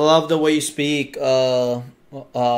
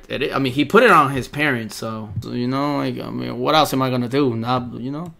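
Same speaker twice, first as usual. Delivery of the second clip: usual speaking voice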